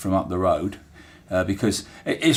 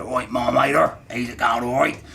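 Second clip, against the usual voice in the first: a light Hampshire burr, a little higher, as a dockyard greeting; 4 semitones higher than usual. Shown as a demonstration. Dockyard worker greeting his mate with a Hampshire burr. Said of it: "you can hear there already, there's kind of that Hampshire burr".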